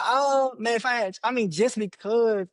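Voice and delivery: ordinary delivery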